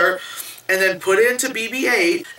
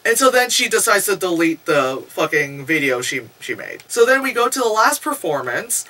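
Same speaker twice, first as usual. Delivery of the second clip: ordinary talk, nothing marked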